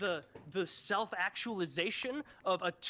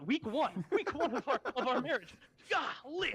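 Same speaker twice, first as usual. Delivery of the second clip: higher, pleading whine; exasperated whine; 7 semitones higher than usual. Retold or imitated. Samson caving to his nagging wife, higher exasperated whine. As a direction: whine it up high, fed up.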